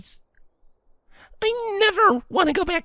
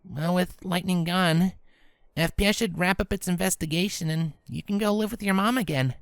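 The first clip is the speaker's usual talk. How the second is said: lower, steady tomboyish pony voice; voiced Rainbow Dash, gentle; 11 semitones lower than usual. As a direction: talk her through it calmly.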